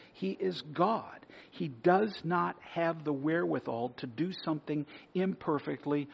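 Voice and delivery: ordinary talk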